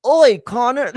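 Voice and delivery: loud, high mock British "Oi, Conor"; British accent. Mocking a British promoter calling out to Conor, hammed-up British accent. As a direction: bark out "Oi" like a cartoon Brit. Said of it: "Here's the conversation".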